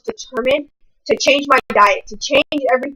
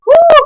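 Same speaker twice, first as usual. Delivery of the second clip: high whoop; 9 dB louder than usual. Real, not acted. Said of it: "I was mad".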